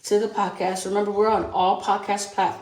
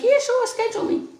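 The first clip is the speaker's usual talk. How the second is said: much higher, breezy mock of a guest saying yes; mocking eager reply; 5 dB louder than usual. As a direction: toss it off like a carefree guest who won't commit.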